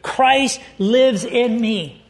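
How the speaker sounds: very slow, each word weighed; solemn slow declaration; slow emphasis. Real, not acted. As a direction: let every word land. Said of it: "underline that phrase".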